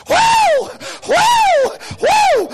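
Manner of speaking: high, loud praise whoops; choir woman's shouting. Retold or imitated. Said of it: "that woman in the choir shouting".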